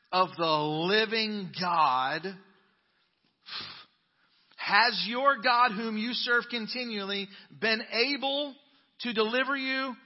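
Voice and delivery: loud, high-pitched anguished calling out; anguished cry. Acted. King calling to Daniel at the lions' den, anguished cry. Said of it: "he cried out in a tone of anguish".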